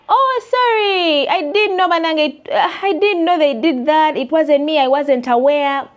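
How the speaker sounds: high, loud whiny mock apology. Imitating the business owner's excuse to her, whiny. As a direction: mimic the client's flustered excuse.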